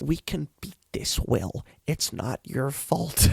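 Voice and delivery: Winona Ryder impression. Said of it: "I can't do a Winona Ryder impression".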